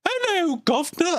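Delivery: very high, hammed-up English accent; squeaky mock English greeting, badly faked accent. Streamer joking for chat, silly high English accent. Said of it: "Do a English accent".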